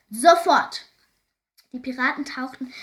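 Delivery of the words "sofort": explosive, high, loud order; furious captain's yell; roared command. A narrator's reading. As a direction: bellow it as a demand for instant obedience. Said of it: "brüllte Knitterbart".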